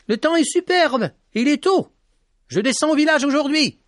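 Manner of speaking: put-on high young boy's voice, eager; boyish Petit Jean. A narrator's reading. Petit Jean tells the lady he is going down to the village, high and eager. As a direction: voice the boy lightly and keen.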